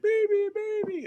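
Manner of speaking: very high, drawn-out mimicry; slow, squeaky-high mock voice; imitated captive. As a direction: draw it out in a high put-on voice. Imitates captive's reply about baby.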